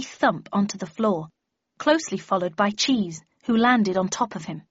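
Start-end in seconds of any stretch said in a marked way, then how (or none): none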